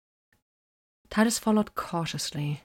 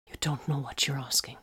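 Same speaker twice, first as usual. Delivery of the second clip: hushed, hollow whisper of dread; flat horror; 3 semitones lower, 7 dB quieter than usual. A narrator's reading. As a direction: whisper it as if frozen by fear. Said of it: "with a strange, flat horror in her voice".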